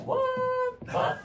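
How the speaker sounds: mock shock. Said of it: "I was like".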